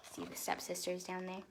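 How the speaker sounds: ordinary delivery, nothing marked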